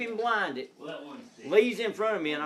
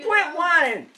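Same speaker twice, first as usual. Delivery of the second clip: mock scolding; 11 semitones higher, 7 dB louder than usual. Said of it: "I said".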